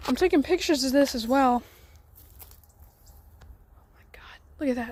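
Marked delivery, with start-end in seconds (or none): 3.8-4.4 whispering. Real, not acted. Startled, hushed while exploring.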